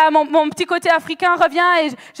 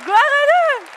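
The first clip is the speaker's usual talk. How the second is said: joyful cheer; 6 semitones higher, much slower than usual. Retold or imitated. Preacher celebrating a new convert, jubilant high shout. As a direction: shout it up to heaven in joy.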